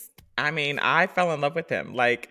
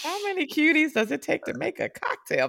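high repeated quote; 12 semitones higher than usual. Retold or imitated. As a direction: say it again lightly for fun. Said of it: "repeat of her own line".

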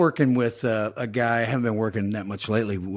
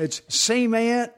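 very slow, high Southern stretch on cement; drawled Southern accent; 12 semitones higher, much slower than usual. Retold or imitated. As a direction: drag cement out like a Mississippi drawl. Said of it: "You can stretch it out".

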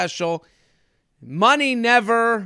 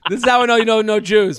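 normal talking voice

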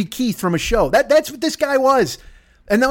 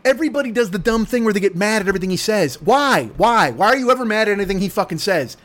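ordinary talk, nothing marked

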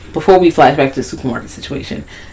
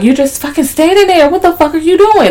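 frantic yell; 13 semitones higher than usual. Retold or imitated. Grayson voiced yelling at bystander.

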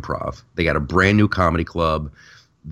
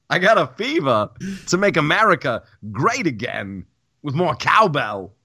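hint of Walken, higher and laughing through it; Christopher Trump; 7 semitones higher than usual. Comedian trying out the cowbell joke for his cohost, mock Walken. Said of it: "I'm gonna write that down".

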